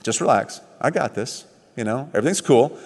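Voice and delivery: calm him down gently like a wife would; a hint of a gentle wife calming her husband; soft soothing wife. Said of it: "her gentle touch".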